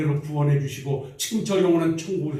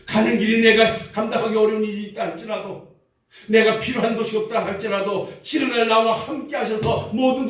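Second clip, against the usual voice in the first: higher, louder exhortation; fervent preaching; 6 semitones higher, 3 dB louder than usual. Real, not acted. Pastor urging the church to carry God's grace, in a high exhorting preaching voice. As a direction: lift the voice and urge them onward.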